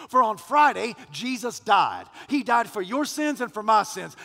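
loud, rising gospel preaching; full-force preaching. Acted. Pastor preaching the resurrection to his congregation, loud and building. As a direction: preach it out to the whole church.

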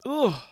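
high, loud groan of disgust; grossed-out groan; disgusted ugh. Real, not acted. Disgust at beard-combing image.

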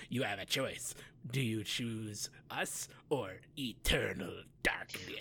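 menacing evil villain hush; Barca villain voice. Acted. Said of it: "That was a good Barca voice".

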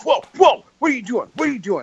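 high-pitched startled yelp, pitch sliding down; imitating a startled freakout. Startled party guy woken by hand-waving, high mock yelp. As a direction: play the jolted sleeper, jumping up in pitch. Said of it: "flipped out".

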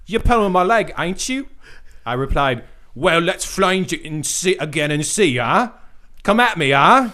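high, loud, cheeky British caricature; hammed-up British accent. Host acts out the producer teasing a musician, hammed-up British accent. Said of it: "I think I changed, like, four times".

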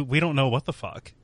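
ordinary talk, nothing marked

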